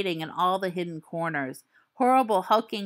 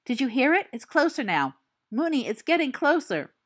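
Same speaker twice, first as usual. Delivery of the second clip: frightened; 7 semitones higher than usual. Acted. Frightened boy.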